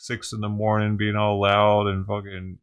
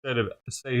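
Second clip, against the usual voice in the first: normal talking voice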